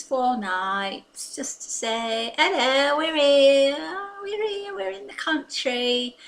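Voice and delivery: a fussy, gossipy English auntie character, put on throughout; chatty old English lady. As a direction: play a cheerful doting English granny.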